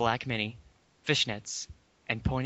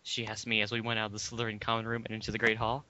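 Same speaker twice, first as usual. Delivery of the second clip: ordinary talk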